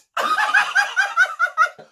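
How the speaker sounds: a squealing burst of laughter; high shrieking laugh. Real, not acted. Laughing at the quote.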